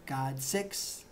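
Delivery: ordinary talk, nothing marked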